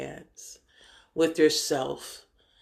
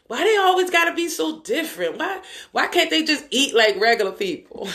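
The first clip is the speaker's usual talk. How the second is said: high whiny mock complaint, half laughing; mocking frustrated people; 8 semitones higher than usual. Mocking the frustrated people around you, whiny and high. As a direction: whine like people who can't stand your change. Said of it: "people frustrated with you".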